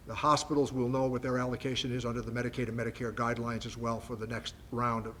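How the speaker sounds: ordinary delivery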